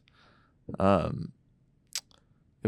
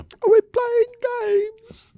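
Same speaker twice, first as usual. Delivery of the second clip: high-pitched imitation; 6 dB louder, much slower than usual. Imitating drunk friend.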